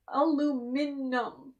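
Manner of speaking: Aluminum said very slowly, al-LOO-min-um; slow, careful American "Aluminum"; slow demo pronunciation. Shown as a demonstration. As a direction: spell it out in sound, very slow. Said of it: "It's all spelled aluminum".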